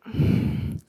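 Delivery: long, acted sigh of exasperation; exasperated sigh. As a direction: let out a weary breath before retorting. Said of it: "And I'm just like".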